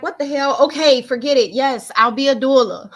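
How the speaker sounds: worked-up, loud reenacted surrender; exasperated giving in. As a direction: give in loudly, half laughing by the end. Acting out her exasperated giving in.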